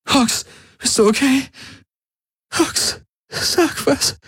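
high, breaking into a whisper; tearful pleading. A narrator's reading. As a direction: plead through tears, voice failing. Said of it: "Tränen rollten über ihre Wangen". (unter Tränen flehend)

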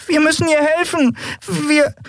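high pleading creature voice. Acted. Yadi pleads, cut off.

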